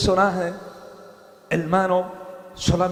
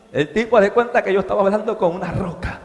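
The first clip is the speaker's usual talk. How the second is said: ordinary talk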